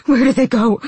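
distraught, lamenting shout; wailed question. A narrator's reading. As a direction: wail it in despair. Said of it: "he wailed".

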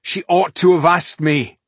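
loud, high, dangerous snarl; angry snarl. A narrator's reading. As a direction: snarl like a wild animal. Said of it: "snarled Tungdil".

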